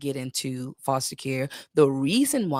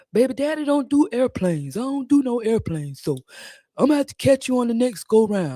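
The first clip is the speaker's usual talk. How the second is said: loud, raised put-on daddy voice; imitating dad; 6 semitones higher, 3 dB louder than usual. Dad telling his daughter he won't fly, imitated. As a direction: act out a father firmly begging off. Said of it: "My dad was like".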